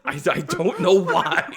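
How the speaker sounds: laughing through the words, high and loud. Real, not acted. Sister laughing as she recaps the episode, real laughter. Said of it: "laughing through words".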